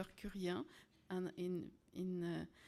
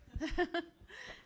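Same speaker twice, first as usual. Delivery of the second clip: light laugh; 9 semitones higher than usual. Real, not acted. Ambassador arrives at podium.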